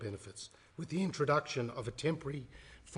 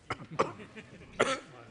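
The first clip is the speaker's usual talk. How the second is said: chesty cough; 8 semitones higher than usual. Real, not acted. Coughing fit during speech.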